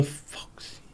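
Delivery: breathy under-the-breath whisper; whispered grumble. Retold or imitated. Grumpy prince whispering fuck's sake at a concert, whispered. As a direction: hiss it unvoiced like a fed-up kid. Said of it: "he's going".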